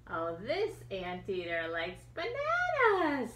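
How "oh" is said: ordinary delivery, nothing marked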